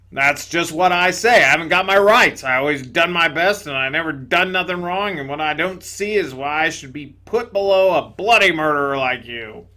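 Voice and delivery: loud, gruff ghost exclaiming; indignant big ghost. Acted. Big ghost to the bright spirit, loud indignation. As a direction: blurt it out loudly, amazed and offended.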